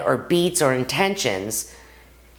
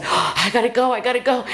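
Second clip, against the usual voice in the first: retold panic; 9 semitones higher, faster than usual. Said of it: "I was like".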